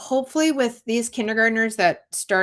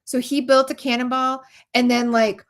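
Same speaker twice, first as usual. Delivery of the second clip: usual speaking voice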